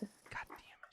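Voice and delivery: hushed whisper; whispering over a crib. Real, not acted. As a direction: whisper so the dog stays asleep. Trying not to wake sleeping dog.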